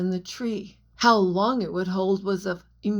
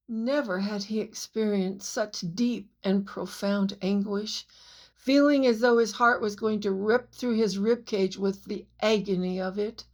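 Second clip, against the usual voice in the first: usual speaking voice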